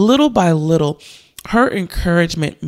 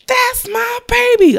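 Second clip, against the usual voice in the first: high-pitched proud-mom squeal; mom screaming. Retold or imitated. Proud mom in the audience squeals for her baby. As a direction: shriek it like a proud mom in the back. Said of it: "I mean, screaming".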